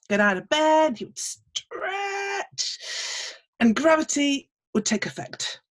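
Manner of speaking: ordinary delivery, nothing marked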